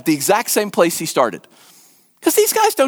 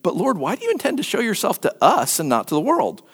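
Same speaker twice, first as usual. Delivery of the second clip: low, measured Bible reading; scripture reading; 7 semitones lower, 4 dB quieter than usual. A narrator's reading. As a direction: read the passage aloud, low and solemn.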